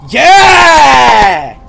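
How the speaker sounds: loud triumphant yell; excited shout. Real, not acted. Triumphant shout after using pick.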